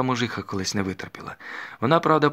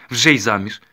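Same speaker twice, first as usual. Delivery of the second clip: louder, a sudden jolt of alarm; startled exclamation; 3 semitones higher, 9 dB louder than usual. A narrator's reading. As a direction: blurt it out as if flinching. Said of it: "здригнеться солдатка".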